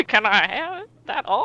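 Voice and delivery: put-on high, loud old prospector asking; hammed-up prospector plea. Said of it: "say it like an old prospector".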